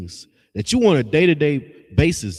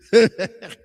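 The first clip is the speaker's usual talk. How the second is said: quick high burst of laughter; real laugh at his own joke; short laugh; 8 semitones higher, 5 dB louder than usual. Real, not acted. Preacher laughs at the wake-and-bake crowd, short real laugh. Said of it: "I'm laughing".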